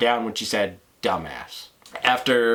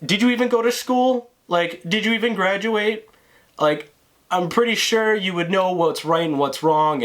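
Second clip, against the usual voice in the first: mocking taunt; 6 semitones higher than usual. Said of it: "And so I'm just like".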